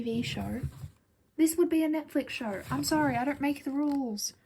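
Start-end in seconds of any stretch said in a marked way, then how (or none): none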